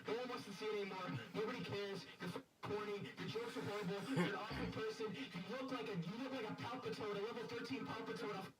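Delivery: angry roasting. Real, not acted. Streamer roasting her on call.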